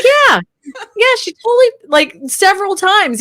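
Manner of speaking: high, emphatic insisting; insistent. Retold or imitated. Wife insisting to husband, high and emphatic. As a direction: pitch way up and insist it's true. Her insisting in retold story.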